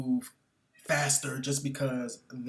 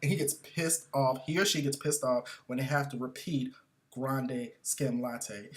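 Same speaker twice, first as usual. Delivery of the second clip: ordinary delivery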